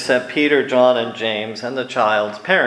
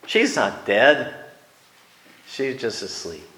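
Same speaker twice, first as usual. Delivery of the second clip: gentle reassurance. Acted. Jesus corrects mourners, shown softer way.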